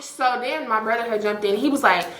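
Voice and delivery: normal talking voice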